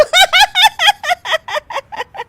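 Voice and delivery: very high rising giggle burst. Acted. Daughter-in-law giggling at mother-in-law, high burst. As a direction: let it burst out. Bahu laughs.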